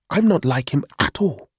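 high, emphatic protest; indignant denial. A narrator's reading.

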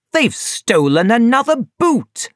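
mild acted shout of outrage; angry shout. A narrator's reading. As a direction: exclaim it angrily, without really shouting. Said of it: "he shouted angrily".

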